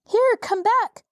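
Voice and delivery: high frightened call after the flying gump; frightened cry. A narrator's reading. Boy on the roof calling the flying gump back, frightened. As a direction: cry out in alarm, voice jumping up. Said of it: "cried Tip in a frightened voice".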